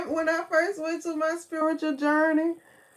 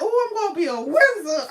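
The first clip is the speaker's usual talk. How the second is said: laughing, fast and louder, thrilled; giddy excitement; 6 semitones higher, 10 dB louder, faster than usual. Retold or imitated. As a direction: rush it out laughing like a kid who just found out. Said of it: "I was like".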